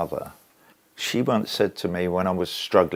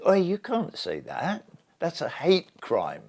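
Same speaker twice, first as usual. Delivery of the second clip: mock objection; 8 semitones higher than usual. Imagined bystander objecting to chant.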